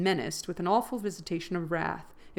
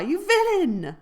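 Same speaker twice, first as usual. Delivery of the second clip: much higher and loud, crying out; outraged cry; 11 semitones higher, 9 dB louder, slower than usual. A narrator's reading. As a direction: hurl the insult in fury. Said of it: "I began to cry".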